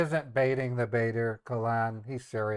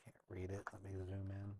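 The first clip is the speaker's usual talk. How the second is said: soft unvoiced mutter to self; whispering; 3 semitones lower, 16 dB quieter than usual. Real, not acted. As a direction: whisper it to yourself. Squinting at small screen text.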